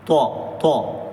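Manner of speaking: تو said heavy and full; thick to demo. Shown as a demonstration. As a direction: give تو a thick, full sound. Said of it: "تو کی آواز موٹی ہوتی ہے".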